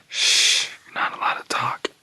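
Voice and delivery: soft, voiceless shushing; hushing whisper. Retold or imitated. Old-timer to chattering new kids, hushing whisper. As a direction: shush them and whisper the rule.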